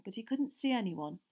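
ordinary delivery

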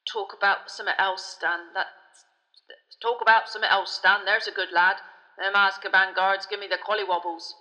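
loud, heavy-voiced uneasy driver; Ern the uneasy driver. A narrator's reading. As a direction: urge your mate to drop the subject.

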